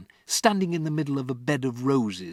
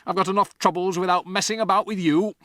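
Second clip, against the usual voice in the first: loud, fast, fed-up dad; irritated father; 8 semitones higher, 6 dB louder, faster than usual. Acted. Father to Matilda, loud fast irritation. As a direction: brush her off quickly.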